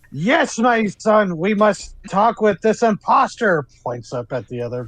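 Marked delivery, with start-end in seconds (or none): 0.1-3.7 high, slow, stately impersonation; fake Barbara. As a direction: intone it grandly as a put-on mother. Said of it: "turn into Barbara, and pop out going".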